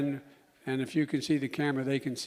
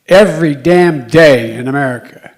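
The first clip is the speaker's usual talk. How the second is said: much louder, punching every damn day; emphatic outburst; 19 dB louder than usual. Real, not acted. Speaker railing about daily shootings, loud and angry. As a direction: slam the words down in anger.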